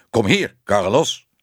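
firm summons to a dog; calling a dog. A narrator's reading. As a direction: order the dog back to you. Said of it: "riep een stem".

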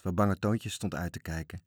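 usual speaking voice

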